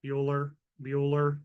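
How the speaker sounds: a hint of a bored teacher calling roll, low; lightly voiced monotone roll call; deadpan low roll call; Ben Stein drone. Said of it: "Ben Stein is so famous".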